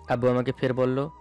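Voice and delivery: ordinary delivery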